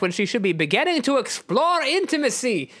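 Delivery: put-on lilting accented character, often high; earnest, swooping accented voice; Nightcrawler. Nightcrawler pities Rogue to Wolverine, in accented voice. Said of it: "you tell Wolverine".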